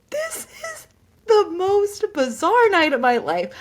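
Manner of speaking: high, slow, loud wail of amazement; hammed-up incredulous squeal; acted disbelief. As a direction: marvel in a drawn-out, high voice. Acting out disbelief on the plane.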